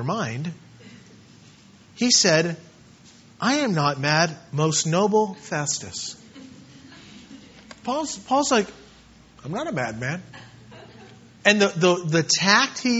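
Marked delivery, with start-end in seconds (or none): 3.4-6.2 low, soft, measured reply; not upset. Acted. Paul answering Festus after being called mad, calm and unoffended. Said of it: "he's not upset, he's not offended". 9.4-10.2 very soft, low, calm denial; Paul, unoffended. Acted. Man called mad answering his accuser, quietly calm. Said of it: "he's not upset, he's not offended".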